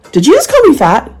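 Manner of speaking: high, loud indignant question; loud comeback. Acted. Ready comeback to a rude stranger, really loudly. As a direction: call it out so the whole room hears. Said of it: "really loudly".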